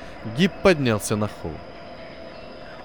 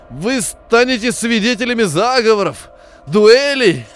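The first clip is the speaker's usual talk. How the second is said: high, strangled voice straining louder; strangled wheeze; 7 semitones higher, 6 dB louder, slower than usual. Acted. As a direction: squeeze the words out, pushing harder. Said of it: "просипел он сдавленным голосом". (сдавленный сиплый голос, срываясь на крик)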